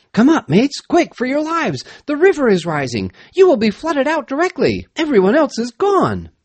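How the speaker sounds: high, alarmed calling down; urgent shouted warning. A narrator's reading. Rescuer to mates down the flooding shaft, urgent shout. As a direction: shout high to get them out fast.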